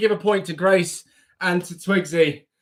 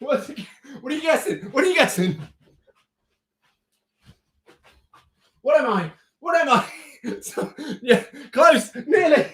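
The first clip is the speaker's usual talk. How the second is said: giggling; 9 semitones higher than usual. Real, not acted. Host laughs while guessing game plays.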